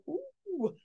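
high, soft awkward "ooh"; small squeamish "ooh" at the tension; hushed, high-pitched cringe noise. Acted. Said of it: "Awkwardness, exactly. Everybody's like".

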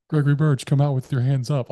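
loud, high police announcement; imitated loudspeaker. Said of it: "like, on this loudspeaker".

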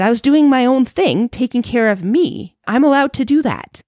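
retold yell at son, high and exasperated; frustrated yell back. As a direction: snap back like a reactive mom. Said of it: "I was yelling back at him because I was reactionary".

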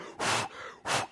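sharp puff of blown air; imitated blowing out of air. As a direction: blow hard on a bubbling pot. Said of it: "what I normally do, I'm like".

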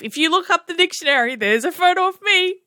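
high, fast mock boast; ironic brag. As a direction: brag sarcastically about being brave. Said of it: "that's me, brave".

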